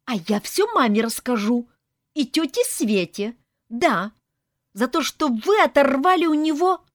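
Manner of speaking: put-on high, loud, petulant kid's voice; whiny child. A narrator's reading. Child in kitchen threatening to tell mom, whiny. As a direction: threaten to tattle like a bratty child. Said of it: "слышалось детское капризное".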